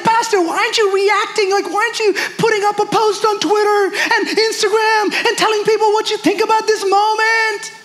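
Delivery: high-pitched frantic venting, hammed up; mock freak-out. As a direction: act out someone demanding you panic too. Acts out someone freaking out, venting.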